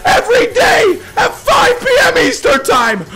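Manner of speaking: high, loud, laughing shouts; screaming with joy. Real, not acted. Streamer to chat after a rare red, screaming with joy. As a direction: scream it like you called the jackpot.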